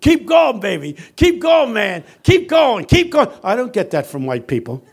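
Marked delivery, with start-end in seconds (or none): 0.0-3.3 high, loud cheering shouts; men's yelling. Retold or imitated. Men on their feet urging the preacher on, yelling. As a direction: urge the preacher on at full voice. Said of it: "they're yelling".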